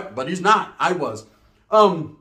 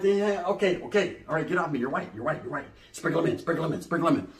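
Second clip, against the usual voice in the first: hushed aside; 6 dB quieter than usual. Retold or imitated. Hushed aside shooing girl off.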